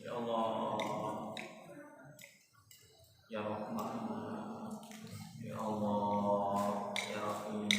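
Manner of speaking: very low, slow, hushed calling of God; soft slow prayer. Shown as a demonstration. Preacher showing how Zakaria prayed, soft and slow. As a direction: barely breathe the words, tender. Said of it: "dengan suara yang lembut".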